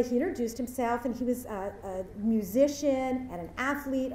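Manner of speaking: normal talking voice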